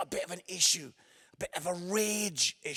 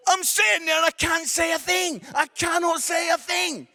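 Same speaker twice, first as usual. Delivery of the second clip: exasperated outburst; 8 semitones higher, 6 dB louder than usual. Acted. Frustrated rant about not speaking.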